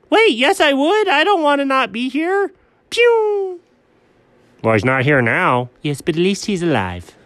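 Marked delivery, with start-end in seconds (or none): 0.1-3.6 very high, panicky cartoon voice; high squeaky protest. Friend protesting he wants to stay here, alarmed. Protests being sent away in skit.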